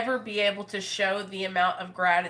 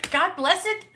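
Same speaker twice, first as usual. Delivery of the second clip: high laugh at herself; real embarrassed laugh; 9 semitones higher, 4 dB louder than usual. Real, not acted. Admitting to viewers she's emotional, laughing.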